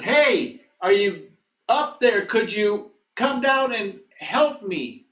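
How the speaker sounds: high, loud shout up from below; calling up for help. Retold or imitated. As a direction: holler up to someone far overhead. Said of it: "And he hears somebody up above, and he says".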